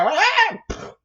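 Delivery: high, loud, short cry; startled yelp. Acted. Mad Gadget thrown onto a cart, high yelp. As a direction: yelp as you are thrown.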